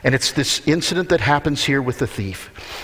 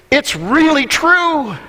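"it's really true" slow, much higher and louder; emphatic; 11 semitones higher, 6 dB louder, slower than usual. Acted. Pastor insisting to his church, emphatic and slow. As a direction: lean hard on "really". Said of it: "repeated twice for emphasis".

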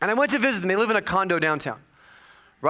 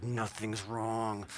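sulky denial; 9 semitones lower, 13 dB quieter, much slower than usual. Retold or imitated. Sulking answer to parents.

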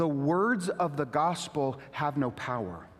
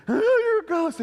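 high, loud hammed-up fright; comic panicked cry; mock terrified disciples; 7 dB louder than usual. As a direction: shriek it like a spooked friend.

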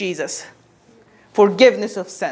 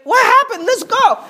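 much higher, urgent and half laughing; hammed-up anxious father pressing Jesus on; Jairus, desperate for his daughter, urging Jesus on, high and hurried; impatient Jairus; 12 semitones higher than usual. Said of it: "And Jairus looks back, is like".

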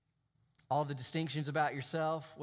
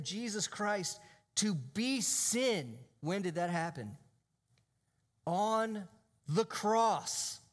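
raised, forceful sermon delivery with drawn-out key phrases; emphatic preaching; 6 semitones higher, 4 dB louder than usual. Real, not acted. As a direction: preach it with conviction, punching the key words.

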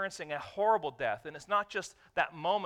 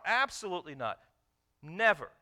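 NEVER hit loud and hard; emphatic denial; 2 semitones higher, 5 dB louder than usual. Acted. Preacher to congregation, emphatic Never. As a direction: slam down on Never.

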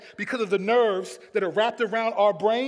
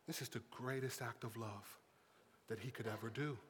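hushed preaching; 11 semitones lower, 20 dB quieter than usual. Acted. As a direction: drop to a grave near-murmur with long pauses.

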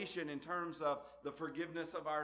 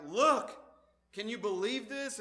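higher and louder, amazed cry; astonished exclaim; 7 semitones higher, 4 dB louder than usual. Retold or imitated. Preacher voicing John's amazement to the congregation, astonished. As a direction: voice John's disbelief. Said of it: "something you'd say when you're surprised".

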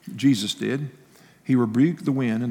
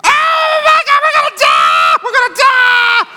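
high-pitched, full-volume mock scream; hammed-up shriek of terror; imitated panicked screaming; 13 dB louder than usual. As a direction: scream it like a hysterical passenger. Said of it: "the guy's daughter starts screaming".

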